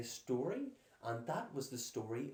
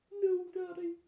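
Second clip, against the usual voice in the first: put-on very high, loud childish cry; crying Goldilocks; 8 dB louder than usual. A narrator's reading. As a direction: protest tearfully to her father. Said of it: "cried Goldilocks".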